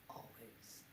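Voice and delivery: barely breathed, fading whisper. Acted. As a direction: let the last word die in a whisper. Trailing self-pitying monologue.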